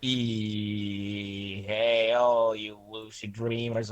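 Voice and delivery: slow, dragged-out greeting; slow mock intro. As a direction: stretch the greeting out lazily. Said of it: "I'm kidding".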